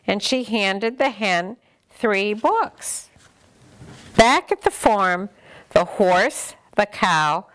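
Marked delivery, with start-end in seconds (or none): none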